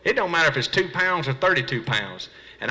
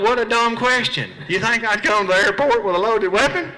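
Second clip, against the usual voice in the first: mocking inner voice; 7 semitones higher than usual. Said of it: "'Cause I thought".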